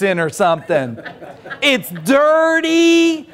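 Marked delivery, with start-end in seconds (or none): 1.6-3.3 disgusted. Retold or imitated. Disgust at dirty caravan.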